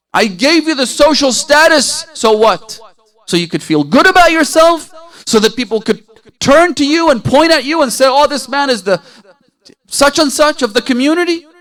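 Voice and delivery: loud, high, indignant challenge; acted rebuke. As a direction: hurl scornful questions as a scolding Imam.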